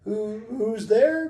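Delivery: high, slow, hesitant question; wary calling. Acted. Second little pig answering a knock, wary calling. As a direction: call out uncertainly, voice climbing. Frightened pig calls at the door.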